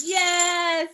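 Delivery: sudden high happy shout; excited cheer. Real, not acted. Teacher cheering Sally's win, excited shout.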